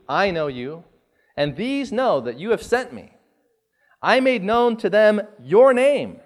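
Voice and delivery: solemn, projected Bible reading with swelling phrases; scripture reading. Acted. Preacher reading Jesus' prayer to the Father, solemn scripture reading. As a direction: read the prayer aloud in measured, declaimed phrases. Reads scripture aloud to congregation.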